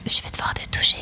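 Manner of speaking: soft breathy honeyed whisper; honeyed little voice. Retold or imitated. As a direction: coo it sweetly, barely voiced. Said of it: "Avec une petite voix mielleuse". (petite voix mielleuse chuchotée)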